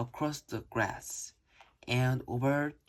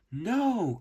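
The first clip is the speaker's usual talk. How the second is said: yelling; 6 semitones higher than usual. A narrator's reading. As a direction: yell it out in alarm. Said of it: "Kyla yells".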